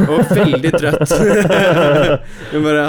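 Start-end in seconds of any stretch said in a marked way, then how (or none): 0.1-1.1 laughing. Real, not acted. Quoting girlfriend, laughing. 1.2-2.5 high, loud burst of laughter. Real, not acted. Girlfriend retelling he was confused, real laughing.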